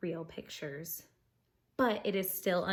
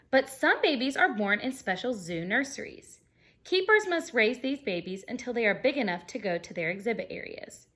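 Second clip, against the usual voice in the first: bright, lively storybook reading; animated read-aloud for kids; reading aloud to children; 3 semitones higher, 7 dB louder than usual. Acted. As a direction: read it like story time for a class. Reading picture book to class.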